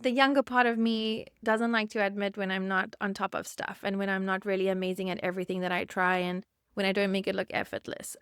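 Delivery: normal talking voice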